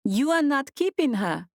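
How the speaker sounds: put-on high, surprised question in an African accent; a disbelieving woman with a thick African accent; heavy African accent. A narrator's reading. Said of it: "she asked in her heavy African accent".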